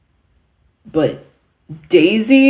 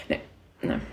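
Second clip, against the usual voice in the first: normal talking voice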